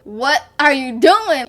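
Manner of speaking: loud, slow, shocked demand; drawn-out loud challenge to the man shoving you; retold confrontation. Being shoved by mom's boyfriend.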